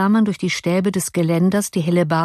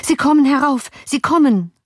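high, urgent calling; alarmed cry; 9 semitones higher, 4 dB louder than usual. A narrator's reading. Servant seeing soldiers on stairs, crying out in alarm. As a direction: cry out a frightened warning. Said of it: "rief der Diener".

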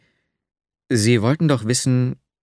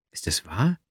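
whisper; 4 semitones lower, 5 dB quieter than usual. A narrator's reading. Shy lovestruck question.

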